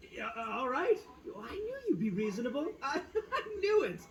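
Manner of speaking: laughing with relief, a high chuckle running through the words; relieved laughing. Real, not acted. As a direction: chuckle through the words, pleased they're on your side. Itto relieved they agree.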